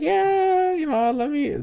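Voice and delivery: high, strained, stalling voice; nervous squeak. Retold or imitated. Teen asked by the cop for parents, high nervous stall.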